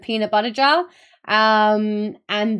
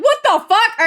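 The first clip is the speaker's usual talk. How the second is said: high, loud mock exclamation; quick high-pitched retold outcry; retold outburst; 12 semitones higher, 8 dB louder than usual. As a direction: blurt it high and loud, retelling your own disbelief. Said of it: "And I was like".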